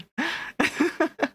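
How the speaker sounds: bright high-pitched giggle. Real, not acted. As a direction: break into a high little laugh. Giggle after teasing chat.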